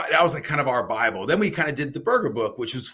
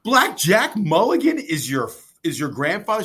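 retold amazement; 5 semitones higher, 8 dB louder than usual. Retold disbelief at news.

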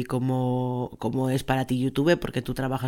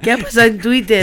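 dramatic announcement; 7 semitones higher, 6 dB louder than usual. Acted. Announcing segment title dramatically.

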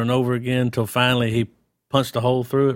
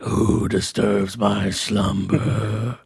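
very slow, low booming demon voice; grinding stone statue; 4 semitones lower, much slower than usual. Retold or imitated. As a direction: drag each word out like a cosmic villain. Voicing Dormammu in retold scene.

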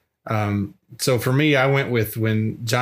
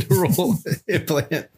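laughing; 7 semitones higher than usual. Real, not acted. Laughing through the term.

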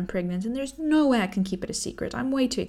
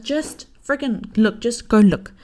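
slow, louder, urgent demand; exasperated insisting; 3 semitones higher, 8 dB louder, slower than usual. Retold or imitated. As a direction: push every word, fed up and urgent. Urging husband to see test.

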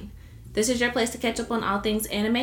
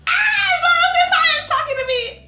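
overacted anime dub; 4 dB louder than usual. Fanboy squeal impression.

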